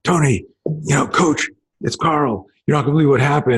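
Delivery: lightly voiced friend bursting with news; excited friend calling. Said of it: "started, he goes".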